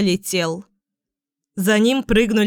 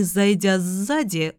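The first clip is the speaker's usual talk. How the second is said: usual speaking voice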